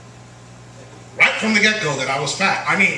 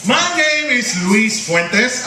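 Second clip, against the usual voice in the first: put-on Puerto Rican accent; 6 semitones higher, slower than usual. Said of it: "pardon my accent".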